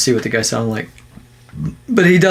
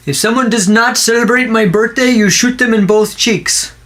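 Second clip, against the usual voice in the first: mock preacher; 7 semitones higher than usual. Mock Jesus commandment.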